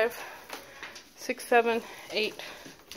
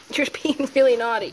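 scolding; 6 semitones higher, 7 dB louder than usual. Real, not acted. Scolding the biting puppy.

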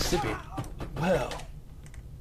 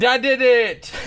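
triumphant shout; 8 semitones higher, 10 dB louder, slower than usual. Real, not acted. Succeeds at a jump.

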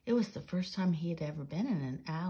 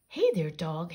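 hailing; 6 dB louder than usual. A narrator's reading. Peter Painter hails a dog in the alley, calling out. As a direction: call out a friendly hail, louder. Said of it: "hailed Peter Painter".

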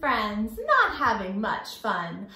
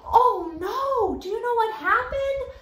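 loud, much higher gasp and oh no; mock shock; 11 semitones higher, 6 dB louder than usual. Teacher gasps at the children as the fish is eaten. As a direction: play up the shock for the kids.

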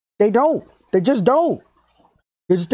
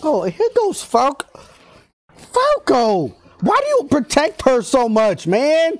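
high-pitched, exasperated complaint; worked-up viewer heckling; exasperated outburst; 6 semitones higher than usual. Real, not acted. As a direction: plead with the character in high exasperation. Scolding a character on screen.

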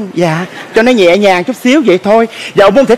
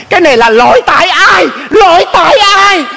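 rising exasperated demand; 7 semitones higher than usual. Acted. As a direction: push the pitch up and stretch the repeat in mounting exasperation. Child demands who is to blame.

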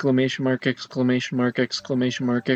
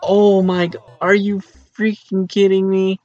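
higher, louder shocked outburst; loud disbelief; 5 semitones higher, 7 dB louder than usual. Real, not acted. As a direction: shout in disbelief at what just happened. Sees crazy moment with Ninja.

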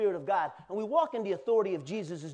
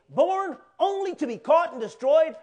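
preaching shout; 9 semitones higher, 10 dB louder than usual. Real, not acted. Preacher reading scripture at full force.

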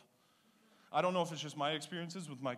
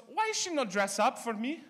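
put-on high, slow Italian-accented complaint; Italian mother; 7 semitones higher, 5 dB louder than usual. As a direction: pout it like an offended Italian mother. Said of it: "She goes".

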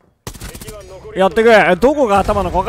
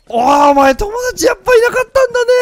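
high, loud teasing jab at the streamer; mocking viewer voice; 12 semitones higher, 7 dB louder than usual. Viewer mocking the streamer for having no friends, high teasing voice. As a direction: sneer it like a smug viewer who knew it. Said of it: "とか思ってると思うんですけど".

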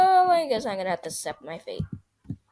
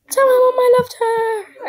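dramatic dying shout; 13 semitones higher, 7 dB louder than usual. Pretend. Farewell line shouted again.

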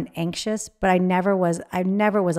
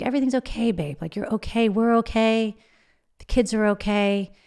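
imitating him, pitched higher, soothing and gentle; reassuring; 5 semitones higher than usual. Husband soothing his frightened wife at night, a higher reassuring voice. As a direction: play the husband calming her, voice lifted.

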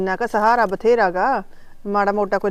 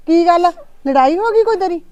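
upset; 8 semitones higher, 5 dB louder than usual. Acted. Mother upset by daughter's news.

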